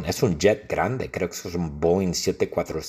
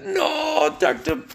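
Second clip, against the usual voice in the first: much higher, wailing mock Trump; Trump crying out; slower than usual. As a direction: squeal like a panicked Trump begging. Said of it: "Donald Trump grita". (Trump grita agudo y lloriquea por su cuchara)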